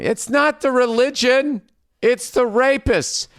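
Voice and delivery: high-pitched, strained comic yell; hammed-up high indignant rant; mock outraged protest. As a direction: cry out in exasperated mock defense. Mock protest capping a sarcastic rant.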